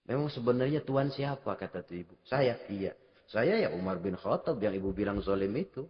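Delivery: sangat pelan dan lirih, lebih rendah; bisikan lirih. Acted. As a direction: bawakan adegan ini dengan lirih menyentuh. Pengakuan Umar lirih. (penceramah membawakan adegan haru dengan suara lirih)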